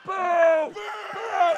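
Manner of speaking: mock crowd jeering loudly; loud boos. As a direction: boo like a heckling crowd. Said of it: "those really loud boos".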